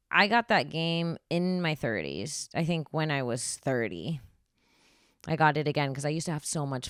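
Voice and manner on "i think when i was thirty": usual speaking voice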